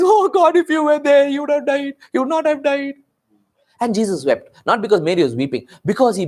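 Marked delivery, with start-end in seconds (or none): 0.0-2.9 high, sustained hammed-up lament; mock wailing. Preacher acting out Mary's grief to Jesus, mock wailing. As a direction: wail it out high, imitating a grieving woman. Acts out weeping Mary confronting Jesus.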